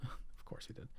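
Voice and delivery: laughing under breath; whispered laugh. Real, not acted. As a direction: chuckle it out quietly. Laughs at a missed item.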